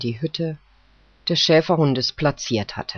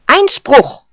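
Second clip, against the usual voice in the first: sharp, much higher and louder objection; barked; 10 semitones higher, 10 dB louder than usual. Acted. Poodle barks his objection at the judge, loud. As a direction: bark the objection out. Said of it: "bellte der Pudel".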